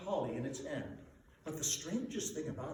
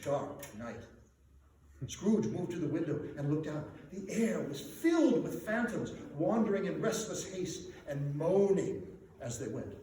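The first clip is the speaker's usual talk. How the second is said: usual speaking voice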